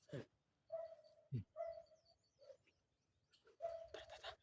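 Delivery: soft unvoiced whisper; whispering while hiding. Real, not acted. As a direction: whisper so nobody hears. Sneaking after someone in game.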